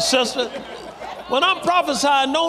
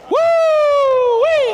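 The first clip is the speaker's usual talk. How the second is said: loud, much higher whoop of delight; excited whoop; 12 semitones higher, 5 dB louder than usual. Real, not acted. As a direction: let out a joyful holler. Preacher exults after the prophecy.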